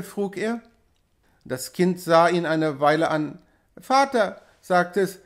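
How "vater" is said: put-on high little child's voice; a child appealing to father, pitched high; small child. A narrator's reading. Wienke asking her father, high childlike voice.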